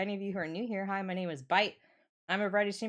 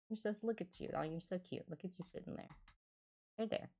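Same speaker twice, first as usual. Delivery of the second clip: cooing; 2 semitones higher, 8 dB quieter, faster than usual. Real, not acted. Cooing at viewers on camera.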